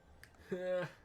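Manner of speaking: short villainous laugh for Garuda; Garuda's laugh. Acted. Voicing Garuda laughing at the heroes, a mocking laugh. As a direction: laugh down at the intruders like a wind goddess. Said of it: "Garuda's laughing".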